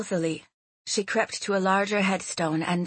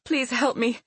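higher-pitched, anxious plea; urgent plea; 6 semitones higher than usual. A narrator's reading. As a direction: beg for help at the door. Fleeing woman begs butler for help.

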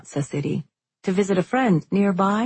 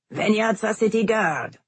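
muffled voice; 5 semitones higher than usual. A narrator's reading. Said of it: "came a muffled voice".

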